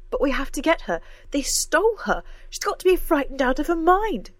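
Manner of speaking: loud, high, desperate cry; cried out. A narrator's reading. Cora cries out that they must rescue her, loud and desperate. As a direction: panic for her missing friend. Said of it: "cried Cora".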